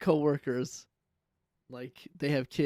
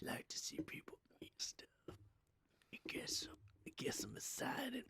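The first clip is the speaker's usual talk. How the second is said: hushed conspiratorial whisper; 10 semitones higher, 17 dB quieter than usual. Acted. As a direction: whisper it like a dirty secret. Whispering a risqué remark.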